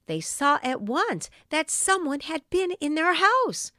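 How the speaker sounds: plain reading voice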